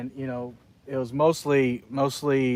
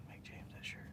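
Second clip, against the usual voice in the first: whisper; 28 dB quieter than usual. Real, not acted. Aside to Kevin off camera.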